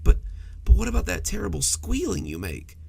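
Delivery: a mildly wary question; probe, half-distrusting; suspiciously. A narrator's reading. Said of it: "it added suspiciously".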